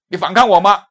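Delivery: loud, high, domineering challenge; mock police bark. Young officer barks at the detained preacher, loud and domineering. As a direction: bark it like a bullying young cop.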